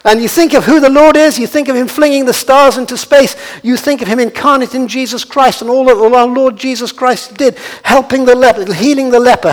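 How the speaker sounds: high, loud, driving sermon voice; fervent proclamation, pitched high and loud; impassioned preaching. Real, not acted. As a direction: preach it at full force, building to ringing cries. Preaches at full force.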